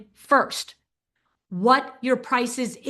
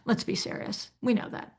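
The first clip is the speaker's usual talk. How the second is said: whisper; 4 semitones lower, 8 dB quieter than usual. Real, not acted. Aside, unvoiced.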